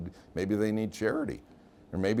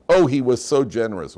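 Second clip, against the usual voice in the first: loud, emphatic mourner's praise; mock eulogy praise; 3 semitones higher, 10 dB louder than usual. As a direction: burst out with imitated funeral praise. Acting out praise at a funeral.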